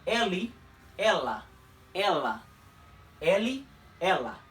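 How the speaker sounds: "Eli" ending in an i sound, "ela" with a drawn-out L, slowly; Portuguese eli, ela. Shown as a demonstration. Teacher repeating pronouns for students, slow and careful. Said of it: "la L de ela es un poquito más alargada".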